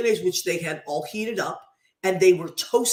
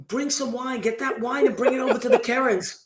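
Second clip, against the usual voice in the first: a light, higher imitation of mother giving orders; imitating mother; 6 semitones higher than usual. Mother telling the kids to take wine to the neighbours, lightly imitated. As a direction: toss it off like mom sending you out the door. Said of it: "my mother always used to say".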